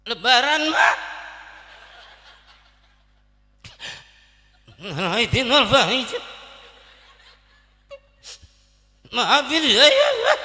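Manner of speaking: hammed-up choked sobbing, words breaking into wails; holding back tears. Grown child begs parents' forgiveness at Lebaran, holding back hammed-up sobs. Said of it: "Nahan nangis, Bang".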